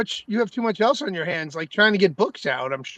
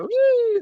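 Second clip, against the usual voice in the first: high held whoo; sustained high-pitched woo on reappearing; playful whoo. Pretend. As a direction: let out a long, high woo. Popping back on camera.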